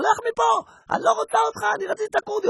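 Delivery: very high panicked refusal; startled woman shrieks very high; frightened shriek. Acted.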